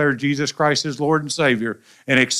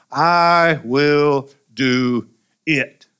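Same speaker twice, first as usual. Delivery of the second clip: "I will do it" spaced out, each word weighed; slow emphatic promise; 3 dB louder, much slower than usual. Shown as a demonstration. Preacher stressing Jesus' promise to the church, very slow. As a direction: drag out "I will do it". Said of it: "Did you catch that?".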